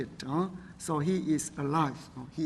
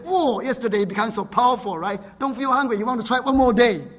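raised, louder, enthusiastic quote; excited retelling; 4 semitones higher, 7 dB louder than usual. Retold or imitated. Preacher retelling a brother's fasting victory to the congregation, excited. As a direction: relay his triumph with mounting energy.

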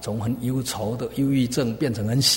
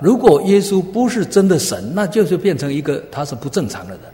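loud, high, forceful argument from the pulpit; emphatic preaching; 7 semitones higher, 9 dB louder than usual. Acted. Preacher arguing to the congregation that Jesus is God, emphatic preaching. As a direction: open high and loud, driving the point home.

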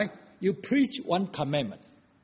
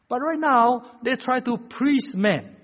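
slow, high, loud pulpit emphasis; forceful preaching; 10 semitones higher, 9 dB louder, slower than usual. Real, not acted. Preacher warns against pleasing men, forceful preaching. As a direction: lean hard on every word.

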